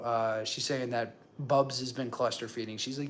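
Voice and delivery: ordinary talk, nothing marked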